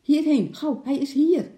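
excited faraway shout; excited call from afar. A narrator's reading. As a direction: shout it across the woods, thrilled. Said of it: "Vaders stem in de verte klonk vol opwinding".

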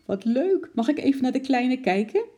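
high, delighted cooing request; verrukte winkeljuffrouw. A narrator's reading. Shopkeeper delighted about supposed new baby. (verrukt, met hoge stem)

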